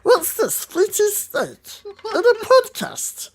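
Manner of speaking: very high, slow, strange creature voice; Gollum. Acted. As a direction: squeak it out slowly like a creature. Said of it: "Lord of the Rings impressionist".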